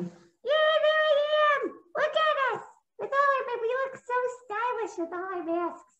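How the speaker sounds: very high, thrilled little voice; a shy snail finally delighted; very high, excited puppet. Acted.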